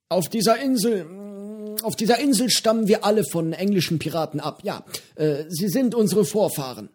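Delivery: höhere, lockere Piratenstimme, freundlich plaudernd; Johnny, der alte Pirat, prahlt gemütlich mit seinem Fisch; gutmütiger Pirat Johnny. A narrator's reading. Johnny erklärt die Herkunft. (höhere Stimme eines gutmütigen alten Piraten)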